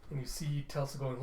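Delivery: usual speaking voice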